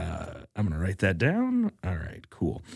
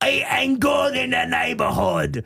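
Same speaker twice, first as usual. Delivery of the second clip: high, slow, drawn-out Aussie ad parody; Australian commercial voiceover; 13 semitones higher than usual. Acted. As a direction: stretch each slogan in a hammed-up Australian accent. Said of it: "voiceover for an Outback Steakhouse commercial".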